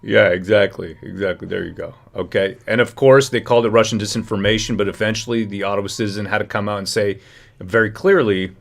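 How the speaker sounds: ordinary talk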